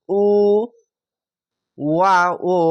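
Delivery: উ then ওয়া ও drawn out as one long u; long u sound. Shown as a demonstration. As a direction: stretch the উ for one alif length. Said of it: "ওয়াও সাকিন থাকলে এক আলিফ টেনে পড়বেন".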